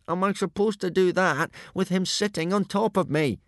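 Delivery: high voice from a mashed face; indignant, high, squashed question; squashed mouth. A narrator's reading. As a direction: protest through one corner of the mouth. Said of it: "out of one corner of his mashed mouth".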